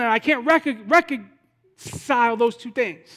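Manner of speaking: loud, high-pitched confessional preaching; impassioned preaching. Real, not acted. Preacher confessing his failings to congregation, impassioned. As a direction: pour out the struggle with full force.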